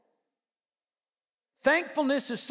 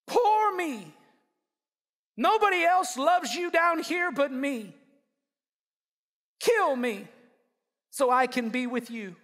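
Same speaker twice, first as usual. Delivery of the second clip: self-pity. Acted. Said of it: "self-piteously says".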